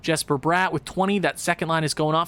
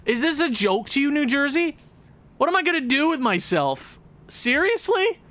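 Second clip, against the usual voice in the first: exasperated; 7 semitones higher than usual. Real, not acted. Yelling at his losing team.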